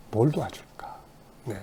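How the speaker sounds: breathy whispered offer of help; whispered kind question. Retold or imitated. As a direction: whisper it kindly. Counselor greeting a scolded child. (속삭이는 다정한 질문)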